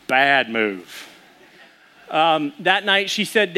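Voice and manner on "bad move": low, drawn-out disapproving verdict; imitating wife. Wife scolds husband over breakfast, low mock disapproval. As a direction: drop it low and slow in reproach. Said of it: "my wife's like".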